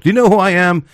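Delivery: high puffed-up mock challenge; mock tough guy. Mocking the chest-poker, high and puffed up. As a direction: play the chest-poker asking who he is.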